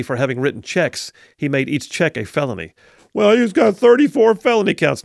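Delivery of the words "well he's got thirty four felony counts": higher, louder mocking quote of the critics. Host mocking critics in a higher, louder, scornful voice. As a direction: sneer it like the other side says it.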